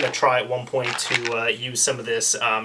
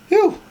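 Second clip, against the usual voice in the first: relieved phew; 5 dB louder than usual. Real, not acted. Relief as system powers on.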